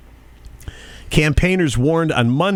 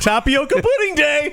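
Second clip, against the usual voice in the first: mock excited speaker; 12 semitones higher than usual.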